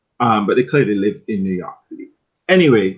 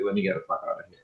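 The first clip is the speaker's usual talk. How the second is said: muttered aside; 4 semitones lower, 12 dB quieter, much faster than usual. Retold or imitated. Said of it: "I was just like".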